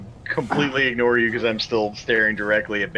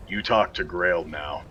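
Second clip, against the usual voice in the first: slow, lower-pitched command; threatening order; 4 semitones lower, slower than usual. Acted. Sword under the bandit's chin, threatening order. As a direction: lay it down slowly as a threat.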